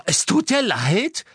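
slow, high, loud echo of an apology; outraged disbelief. Acted. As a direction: throw the apology back furiously. Hermine empört über die Entschuldigung.

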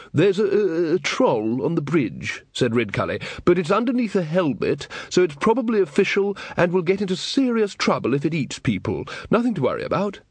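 high, stammering reassurance; nervous wizard. Acted. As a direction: reassure while rattled.

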